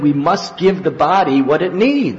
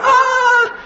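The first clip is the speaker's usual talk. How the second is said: high, louder mock scream; screaming in sleep; 7 dB louder than usual. Devotee screaming in the dark, loud shriek. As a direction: shriek like a dreamer in terror. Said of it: "He's going".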